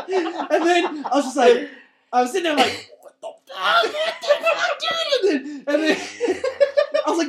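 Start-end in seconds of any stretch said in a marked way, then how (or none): 3.0-5.3 much higher, laughing what the fuck; laughing disbelief. Retold or imitated. Retelling to friends his shocked reaction, high laughing disbelief.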